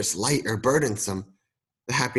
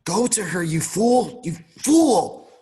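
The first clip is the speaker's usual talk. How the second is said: higher, louder cry of self-reproach, building; anguished shout; 8 semitones higher, 4 dB louder, slower than usual. Acted. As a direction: yell at your younger self. Old Scrooge shouting at young self.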